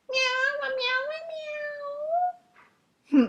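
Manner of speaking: very high, drawn meows; cat meowing. A narrator's reading. Cat showing rooster how to crow, high meowing. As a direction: meow high as if crowing. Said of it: "I'll show you".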